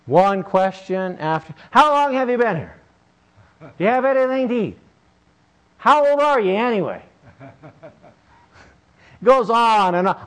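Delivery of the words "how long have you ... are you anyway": put-on pestering boy. Boy pesters old fisherman.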